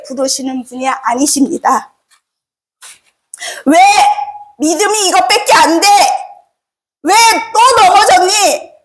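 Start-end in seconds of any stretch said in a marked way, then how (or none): 3.6-8.7 mock rebuke. Preacher voicing a scolding God to the congregation, mock rebuke. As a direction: play a stern God scolding the fallen, half in earnest. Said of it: "왜 이렇게 책망하시는".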